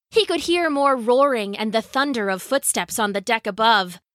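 usual speaking voice

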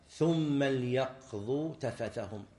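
ثم ليقضوا very slow, as "thumma l-yaqdu"; slow recital, ليقضوا with lam sukun; silent lam after thumma. Shown as a demonstration. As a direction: join ثم straight onto a vowelless lam in ليقضوا. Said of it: "شوف بعد ثم".

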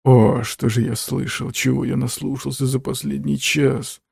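ordinary delivery, nothing marked

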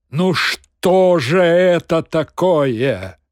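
loud, slow exclamation; exclaiming. A narrator's reading. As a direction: cry out in dismay. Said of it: "воскликнул Воланд". (громкое восклицание)

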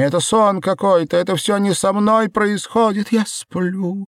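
very high, loud, distraught wail; hysterical disbelief. Acted. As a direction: wail it as if trapped in a nightmare. Белка hysterical, hands on face.

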